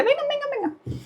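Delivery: high sing-song call to a pet; coaxing the cat. Real, not acted. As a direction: coax a frightened cat out from under the bed. Calling the scared cat out. (llamando al gato con voz aguda y cantarina)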